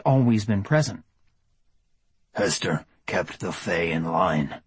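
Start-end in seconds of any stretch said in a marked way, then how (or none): 2.3-4.6 low, slow growling inner wolf; deep slow beastly rumble. Acted. The wolf speaks inside Charles.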